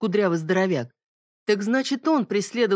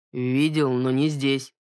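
lower, grudging mutter; reluctant grumble; 4 semitones lower, slower than usual. Acted. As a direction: admit it unwillingly, lower. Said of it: "неохотно буркнул". (неохотно буркнул)

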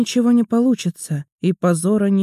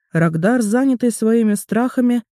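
plain reading voice

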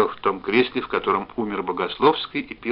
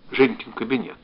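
plain reading voice

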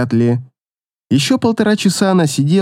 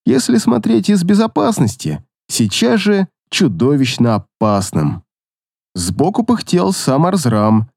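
ordinary delivery

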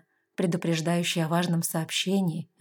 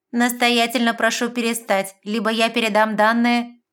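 loud, higher machine warning; electronic voice; 5 semitones higher, 7 dB louder than usual. A narrator's reading. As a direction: warn like a security computer. Machine system warns her.

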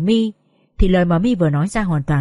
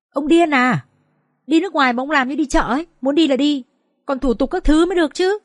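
higher-pitched incredulous protest; exasperated scolding; 5 semitones higher than usual. Acted. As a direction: scold him for an absurd demand. Toàn scolds a panicking old man.

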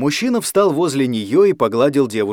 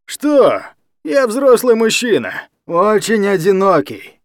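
put-on slow, high, loud drunken self-pity; drunk speech; 8 semitones higher, 4 dB louder, slower than usual. Drunk guardian excusing himself to Anya, slow and slurred. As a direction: labour over each word like a drunk. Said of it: "прилагал значительные усилия, чтобы выговаривать слова чётко".

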